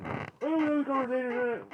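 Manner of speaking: high, very loud mimicked yell; mock outburst. Mimics the frustrated outburst mid-argument.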